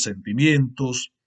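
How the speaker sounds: normal talking voice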